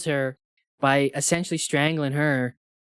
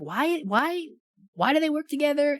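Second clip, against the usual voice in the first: exasperated question; 11 semitones higher than usual. Retold or imitated. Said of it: "I'm like".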